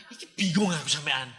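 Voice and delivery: normal talking voice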